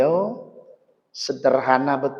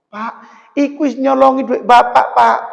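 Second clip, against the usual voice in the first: put-on higher, indignant child; son's protest; 9 semitones higher, 10 dB louder than usual. Son telling his father the boys stole his money. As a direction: object on behalf of the father.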